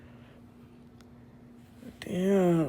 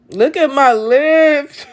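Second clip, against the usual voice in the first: higher, louder and slow, appalled; horrified squeal; 8 semitones higher, 11 dB louder, slower than usual. Real, not acted. Seeing her lips close up on camera, appalled. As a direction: recoil at what the camera shows.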